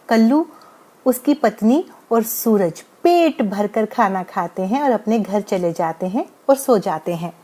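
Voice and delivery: usual speaking voice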